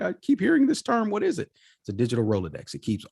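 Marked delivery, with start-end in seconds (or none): 0.0-1.5 confused newcomer. Retold or imitated. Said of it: "that's just like".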